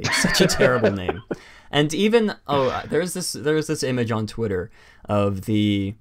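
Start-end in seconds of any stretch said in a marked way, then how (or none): 0.0-1.2 laughing. Real, not acted. Ranting about a dumb name.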